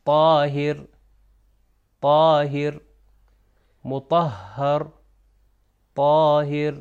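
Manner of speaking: طاہر with a heavy, emphatic t and long vowel; pronunciation model, each of طاہر and مطہر said separately with a thick ط; thick taa. Shown as a demonstration. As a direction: project طاہر firmly, thickening the first sound. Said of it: "تھوڑا سا موٹا تلفظ کیا جائے گا".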